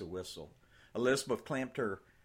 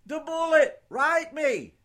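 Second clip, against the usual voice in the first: soldier calling out; 15 dB louder than usual. Acted. Soldier calls out from truck.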